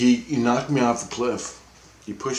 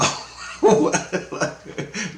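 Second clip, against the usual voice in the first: long breathy chuckle; amused laugh; 10 semitones higher than usual. Real, not acted. Laughing at his own dismay, amused. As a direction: laugh through the memory.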